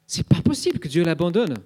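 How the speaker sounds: plus aigu, incompréhension douloureuse; question désespérée. Retold or imitated. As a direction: supplier une réponse, incrédule. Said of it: "c'est la question de Salomé". (Salomé incrédule, voix plus aiguë)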